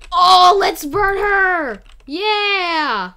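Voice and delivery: high, loud, slow gleeful cheer; rally everyone with glee; loud excited cheer; gleeful shout. Real, not acted. Gleeful plan to burn someone.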